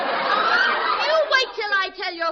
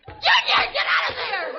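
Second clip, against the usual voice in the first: scolding shout; 5 semitones higher, 5 dB louder, slower than usual. Acted. Mother scolds child.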